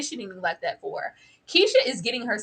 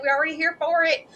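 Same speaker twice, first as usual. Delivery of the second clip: usual speaking voice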